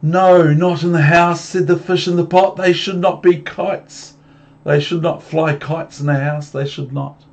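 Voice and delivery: loud, high fussy objection; fish protesting. Acted. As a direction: scold them loud and high.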